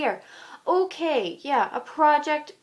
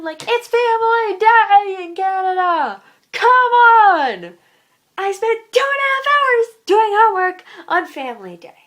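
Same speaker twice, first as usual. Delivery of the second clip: high, loud indignant complaint; exasperated outburst; 7 semitones higher, 8 dB louder than usual. Real, not acted. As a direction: raise the voice in protest, stretching the key words. Angry rant about homework.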